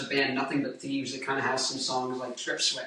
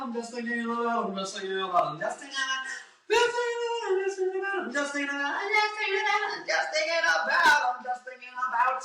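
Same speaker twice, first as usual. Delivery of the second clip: sing-song yawning drill, much higher; vocal exercise; 10 semitones higher than usual. Shown as a demonstration. Singer runs his yawning warm-up drill. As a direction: yawn and articulate every syllable. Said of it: "yawning and then focusing and articulating".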